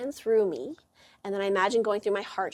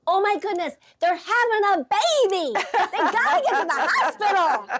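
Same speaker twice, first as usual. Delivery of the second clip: excited make-believe; 10 semitones higher, 6 dB louder than usual. Pretend. Invents a stranger's emergency story.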